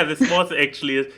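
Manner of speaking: ordinary delivery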